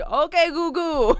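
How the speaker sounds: Italian grandma saying Okay goo goo; Italian grandma accent. Retold or imitated. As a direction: mimic a confused Italian nonna. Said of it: "mimicking what her accent was like".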